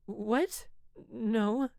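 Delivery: high, loud startled yelp. Acted. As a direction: caught off guard by his teasing. Lena startled by question.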